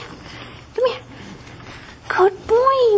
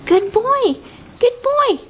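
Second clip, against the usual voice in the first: talking to a dog; 8 semitones higher than usual. Real, not acted. Praising dog on stairs.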